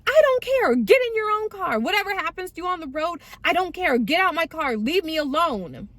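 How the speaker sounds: high-pitched, fed-up tirade; retold angry outburst. Girlfriend kicking drunk boyfriend out of her car, high angry outburst.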